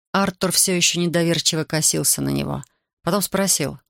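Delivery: plain reading voice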